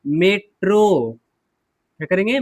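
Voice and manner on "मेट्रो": मेट्रो drawn out slowly, ending on a clear O; slow model word. Shown as a demonstration. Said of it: "M ए T R O".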